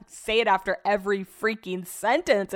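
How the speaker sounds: usual speaking voice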